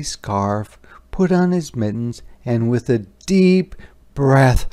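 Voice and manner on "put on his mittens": ordinary delivery, nothing marked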